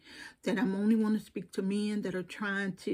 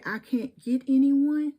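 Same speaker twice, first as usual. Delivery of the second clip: higher, louder mock complaint of a lonely man; mocking whine; 6 semitones higher, 8 dB louder than usual. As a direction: mimic their whining, mockingly. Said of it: "and yet you say".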